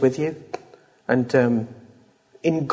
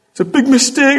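high, loud mock outburst at a spouse; bitter outburst; 11 semitones higher, 6 dB louder than usual. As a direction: fling it out like a furious spouse. Quoted outburst in anger.

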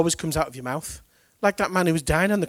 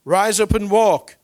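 louder, higher, slow command; commanding call; 6 semitones higher, 11 dB louder, slower than usual. Retold or imitated. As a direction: order him to his feet. Peter commands the lame man.

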